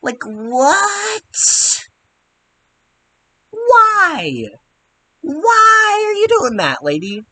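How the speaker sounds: high, stretched why; incredulous outrage. Real, not acted. Reacting to a lady pretending to be Peggy, shrill disbelief. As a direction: shriek it in baffled disbelief. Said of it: "mock outrage at fake Peggy".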